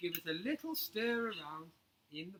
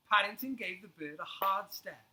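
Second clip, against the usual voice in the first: ordinary delivery, nothing marked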